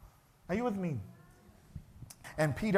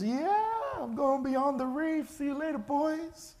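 high, slow, gleeful swagger; cocky glee; 9 semitones higher, slower than usual. Acted. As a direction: show off to the boys in the boat.

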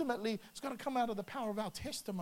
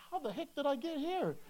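high, lightly hushed disbelief; mock bewilderment; 6 semitones higher than usual. Finding himself praying for musicians, mock bewilderment. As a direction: wonder at yourself in a raised pitch. Acting out own amazed thought.

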